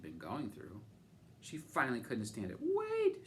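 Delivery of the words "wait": high, loud desperate call; cry out. A narrator's reading. Camilla calling the old woman back, high shout. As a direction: shout it after her to stop her. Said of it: "she cried".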